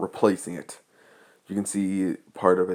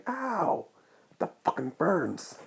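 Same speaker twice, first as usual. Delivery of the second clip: sharp high yelp of pain, then a muttered curse; 10 semitones higher, 5 dB quieter, slower than usual. Acted. A classmate hit by paint yelps in pain. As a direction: yelp as if a burn just stung you. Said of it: "The one who just yelled".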